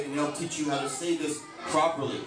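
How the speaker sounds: normal talking voice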